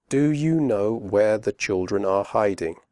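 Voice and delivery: slow with full are; careful slow question; slowly. Shown as a demonstration. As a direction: say each word clearly. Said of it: "first slowly and then quickly".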